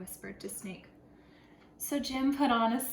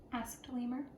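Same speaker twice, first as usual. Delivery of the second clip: ordinary delivery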